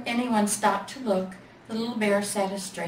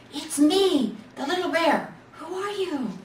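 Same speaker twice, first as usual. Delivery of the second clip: higher small voice; little bear; 8 semitones higher, 3 dB louder than usual. A narrator's reading. Little bear answers the new toy, higher. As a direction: introduce himself shyly.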